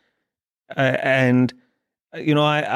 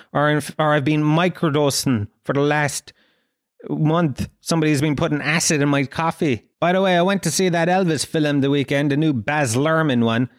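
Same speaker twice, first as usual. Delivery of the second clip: ordinary talk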